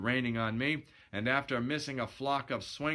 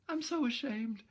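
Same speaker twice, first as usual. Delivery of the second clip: put-on high girlish voice, a touch of sobbing; lightly sobbed confession; mild sob; 12 semitones higher than usual. Gloria confessing her shame to Steve, mildly sobbing. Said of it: "she sobbed".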